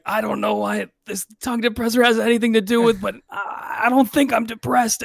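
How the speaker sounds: high, fast bewildered whine; mock-indignant patient voice; patient protesting. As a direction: insist you're fine, baffled. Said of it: "he's like".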